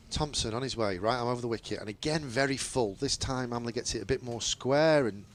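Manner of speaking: lightly voiced cricket commentary, steady and measured; live play-by-play. Commentator calling the ball to radio listeners, measured play-by-play.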